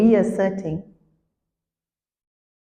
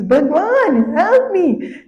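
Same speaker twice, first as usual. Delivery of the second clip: much higher, louder cry to God; scream for help; 10 semitones higher, 4 dB louder, much slower than usual. Retold or imitated. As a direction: scream for Bhagwan in mock panic. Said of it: "almost sadhu screams".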